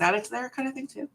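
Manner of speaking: talking with a mouthful; mouth full. Real, not acted. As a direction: speak around a bite of food. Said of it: "I'm trying not to talk my mouth full".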